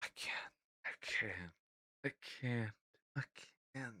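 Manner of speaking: near-silent, exhausted "I can't"; faint repeated I can't; breathless can't. Real, not acted. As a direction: whisper it out, overwhelmed by cringe. Overwhelmed by cringe.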